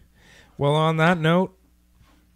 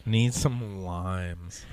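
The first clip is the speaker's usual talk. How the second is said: slow, lower, deadpan repeat of "Need some limes"; slow deadpan echo; 6 semitones lower, much slower than usual. Real, not acted. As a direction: drag "Need some limes" out, lower and flat, mocking the absurdity. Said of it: "mocking repeat".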